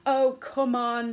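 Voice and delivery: slow, wheedling coax. A narrator's reading. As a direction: talk him into it gently. The mouse coaxes Poppet.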